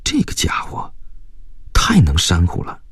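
whispered grumble to oneself; resentful inner thought. A narrator's reading. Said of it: "心里就有几分恨". (心里暗恨，低声嘀咕)

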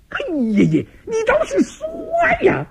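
high, strained angry cry; furious outburst. Acted. Third brother raging at the silent fifth, high strained shout. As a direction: stamp and bellow at your brother, voice cracking. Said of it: "气得那位老三直跺脚".

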